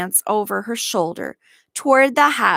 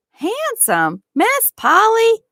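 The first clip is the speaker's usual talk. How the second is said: disbelief; 4 dB louder than usual. A narrator's reading. Nancy disbelieving.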